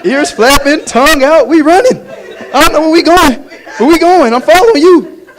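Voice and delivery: a happy dog running along with no idea why; excited dog. Acted. Preacher voicing his loyal dog mid-run, excited and loud.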